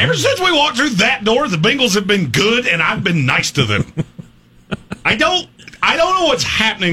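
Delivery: high, laughing disbelief; mock bewilderment. Real, not acted. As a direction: protest as if the world flipped. Mock bewilderment at defending the Bengals.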